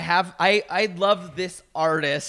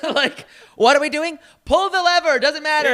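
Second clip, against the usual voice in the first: high, laughing mock dancer voice; 9 semitones higher, 5 dB louder than usual. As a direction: squeal it like a giddy dancer. Mocking the dancers.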